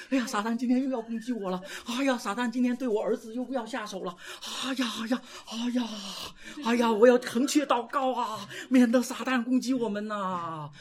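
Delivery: mock fretful believer. (模仿提心吊胆的信徒，语调偏高)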